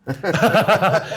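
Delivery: high, loud burst of laughter; laughing. Real, not acted. Telling how he played it cool, real laughter. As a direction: break up at your own story. Laughs at his own story.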